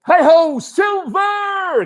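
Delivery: high ringing heroic shout; a mighty cowboy yell to his horse; mighty cry. Acted. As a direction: bellow it to the horizon like a cowboy hero. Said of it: "with a mighty cry of".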